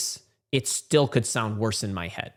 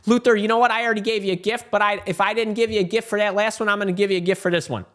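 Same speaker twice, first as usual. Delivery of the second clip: excited; 8 semitones higher, 4 dB louder, faster than usual. Real, not acted. Thrilled by a viewer question.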